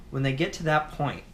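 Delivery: ordinary talk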